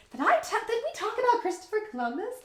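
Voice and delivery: high-pitched incredulous asking; retold baffled question. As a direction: re-enact being puzzled, pitch way up. Acts out baffled question on waking.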